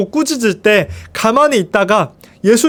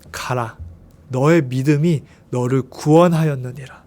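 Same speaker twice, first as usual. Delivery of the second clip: solemn Jesus; 8 semitones lower, 5 dB quieter than usual. A narrator's reading.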